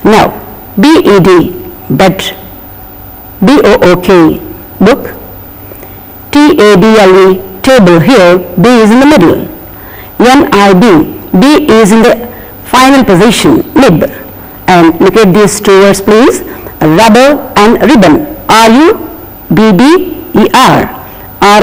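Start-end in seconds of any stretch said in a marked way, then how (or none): none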